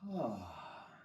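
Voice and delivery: long soft sigh out; relieved sigh. Real, not acted. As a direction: exhale with a sigh. Relaxing after back bend.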